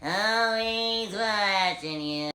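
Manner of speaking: very slow, menacing line; slow villain mimic; creepy quote. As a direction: drag it out slowly. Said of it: "I would try to mimic".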